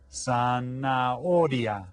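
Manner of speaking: "zanahoria" slow, silent h; slow model word. Shown as a demonstration. Teacher slowly drilling zanahoria, silent h. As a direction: stretch out "zanahoria" with no h sound. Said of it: "Can you say that?".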